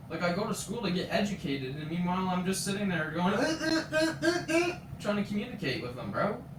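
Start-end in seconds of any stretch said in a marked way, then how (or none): 3.3-4.8 high mock "uh" noises; a string of high-pitched mimicked grunts; imitated wordless grunts. Imitates failed attempt to communicate.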